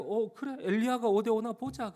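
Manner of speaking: high-pitched mock curiosity. Bystanders jeering about Elijah, mocking. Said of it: "그들의 비웃음이고 그들의 조롱입니다".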